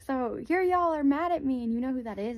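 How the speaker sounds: indignant and loud, how dare you; indignant outburst. Retold or imitated. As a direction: blow up at being blamed for nothing. Indignant at being wrongly blamed.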